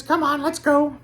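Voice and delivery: very high, loud call; high-pitched put-on voice, loud; Bobby Justin. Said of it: "do a Bobby Justin impression".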